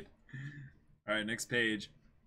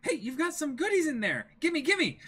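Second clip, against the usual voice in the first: put-on greedy girl; 12 semitones higher than usual. Reading Nami grabbing caltrops.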